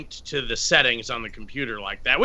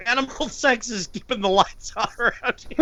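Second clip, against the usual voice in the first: laughing; 6 semitones higher, 3 dB quieter than usual. Real, not acted. Laughing joke line.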